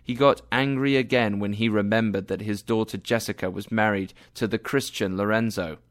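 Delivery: ordinary delivery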